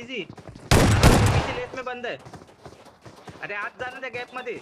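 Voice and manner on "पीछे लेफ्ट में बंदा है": sharp, raised warning shout; urgent callout. Real, not acted. Warning the squad about an enemy behind on the left, shouted callout. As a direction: yell the enemy's position to the squad.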